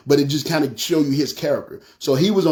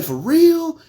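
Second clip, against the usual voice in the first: high, amazed yelp of disbelief; can't believe his luck; disbelieving "for real"; 14 semitones higher, 3 dB louder than usual. Retold or imitated. Teen shown a car he can buy, high amazed disbelief.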